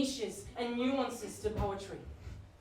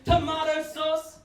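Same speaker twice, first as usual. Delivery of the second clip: slow, louder, grave listing; mock solemn recital; 5 semitones higher, 6 dB louder, slower than usual. Comedian reading a shopping list as a poem, mock solemn. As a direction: declaim each item like verse.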